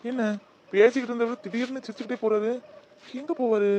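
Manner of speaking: puzzled. Acted. Colleague puzzled as he leaves.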